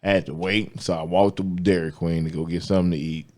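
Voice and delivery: ordinary talk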